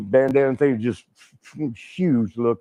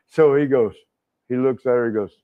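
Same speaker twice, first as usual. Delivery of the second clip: ordinary talk, nothing marked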